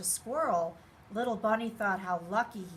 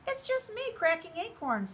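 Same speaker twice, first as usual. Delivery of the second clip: squirrel voice; 8 semitones higher than usual. A narrator's reading. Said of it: "said Squirrel".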